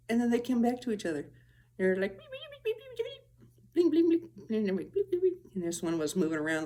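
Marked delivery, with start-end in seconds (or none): 2.2-4.6 very high electronic beeps; squeaky high robotic bleeps; high beeping. Retold or imitated. Imitating the lights' beeping noises. 4.9-5.4 high beeping. Retold or imitated. Imitating the lights beeping again.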